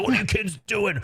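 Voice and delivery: high, put-on imitation of a yelling guard; mock yell. Guard yelling at kids, high mock imitation. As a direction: bark it like the grumpy guard.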